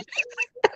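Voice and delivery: a short high squeal of laughter. Real, not acted. Laughing at her own story, a high giggle.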